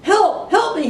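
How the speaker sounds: very high, loud panicked cry for help; shouting for help. A narrator's reading. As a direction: scream as the herd thunders after you. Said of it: "he shouted".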